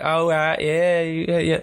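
imitated Florida southern drawl; mock southern twang. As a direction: put on a southern twang like a local kid. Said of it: "southern twang when they talk".

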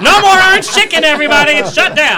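high, loud mock-tragic announcement; hammed-up public announcement. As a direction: announce the doom like a disaster. Mock sponsor loss announcement.

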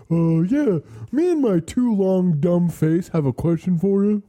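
high, sugary fake-polite voice; chirpy service-desk act; customer service voice. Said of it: "and you're just like".

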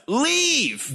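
put-on high old man's cry; hammed-up, high urgent command; Maester Aemon. Giving Gilly advice as the dying maester, high mock cry. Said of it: "He has some advice for Gilly".

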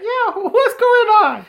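slow, very high talking dog; cartoon dog voice. Even the cartoon dog talks like them, high mock voice. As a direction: drag it out in a silly high voice. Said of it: "the dog would be like".

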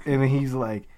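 ordinary delivery

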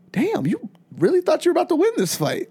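high incredulous question; mock disbelief. Podcast host mocking a fighter's hopes, incredulous. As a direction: act amazed he expected to win. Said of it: "I'm like".

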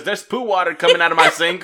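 half-laughing fake complaint; giggling through a pretend call; laughing mock complaint.

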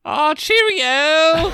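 high, slow hammed-up British; over-the-top posh Londoner cheer; London accent. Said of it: "give me your best London accent".

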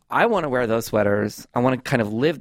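ordinary delivery

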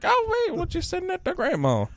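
very high cartoonish squeal; hammed-up falsetto; squeaky silly voice. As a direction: pitch it way up for a silly quote. Acts out a silly quote.

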